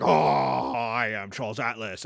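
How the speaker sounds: low, loud-opening hulking roar; Hulk-like strongman. Acted. Newly ripped weakling to his mockers, low hulking boast. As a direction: announce yourself like a hulking brute. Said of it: "the Hulk".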